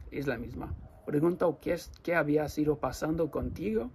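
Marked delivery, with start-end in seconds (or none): none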